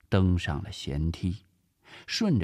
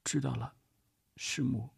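small, soft, higher-pitched assent; obedient; 5 semitones higher, 8 dB quieter than usual. Acted. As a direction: give in meekly and quietly. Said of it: "阿美顺从地说道". (顺从地轻声答应)

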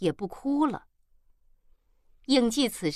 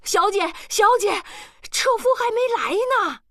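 high, loud maid's cry; calling out; 12 semitones higher, 6 dB louder than usual. A narrator's reading. Maid Li Ma cries out after her departing young lady. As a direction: shout high after someone getting away. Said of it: "李妈叫道".

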